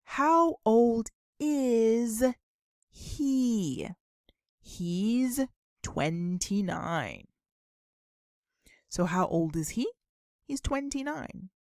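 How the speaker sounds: ordinary delivery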